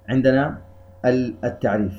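ordinary delivery

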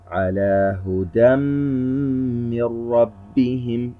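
slow Quran recitation, the nasal hum of من ربهم held long; ghunnah recitation. Shown as a demonstration. A tajweed teacher reciting the example slowly. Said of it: "نأخذ مثال آخر".

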